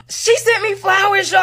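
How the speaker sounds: gasp, then high drawn-out astonishment; high-pitched, stunned disbelief; shocked. Retold or imitated. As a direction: gasp and cry out as if floored by it. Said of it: "I was shocked".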